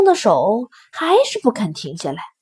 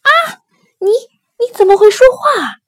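high, loud cry of shock; startled, high-pitched exclamation; astonished cry; 11 semitones higher, 7 dB louder than usual. A narrator's reading. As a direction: gasp out in alarm, voice leaping up. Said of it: "大吃一惊，大叫说".

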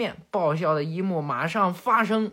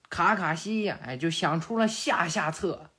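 ordinary delivery

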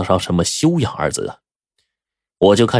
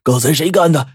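high-pitched, strained, furious character voice; acted outrage from an injured man; angry demand; 9 semitones higher than usual. A narrator's reading. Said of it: "马王爷就怒道".

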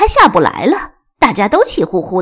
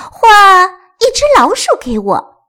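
high, loud whiny cat voice; high drawn-out feline plea; meowing cat; 9 semitones higher, 7 dB louder than usual. Acted. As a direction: mew the demand like a cat. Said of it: "小咪喵喵地叫着".

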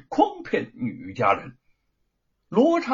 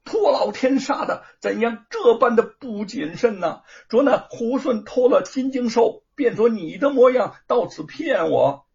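loud, high scolding rant; angry scolding; 7 semitones higher, 6 dB louder than usual. A narrator's reading. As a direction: scold him hard, berating. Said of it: "骂道".

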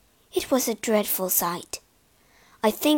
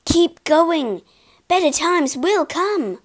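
louder and higher, urging her on; louder, higher pleading cheer; urgent encouragement; 6 semitones higher, 4 dB louder, slower than usual. A narrator's reading. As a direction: push her to keep going. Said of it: "I cried".

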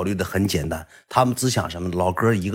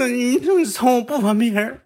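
very high mock tearful whine; imitated crying. Crying junior demanding the truth from big brother, mock sobbing. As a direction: squeal it like a junior in tears. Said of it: "他就哭了".

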